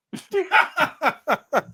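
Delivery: high-pitched burst of laughing; real laughter. Real, not acted. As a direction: crack up in high, pulsing laughs. Laughs at own impression's punchline.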